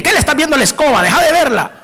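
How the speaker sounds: hammed-up possessive partner; mock jealous scolding. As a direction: rattle off a jealous spouse's suspicion, pitched well up. Jealous spouse scolds over broom.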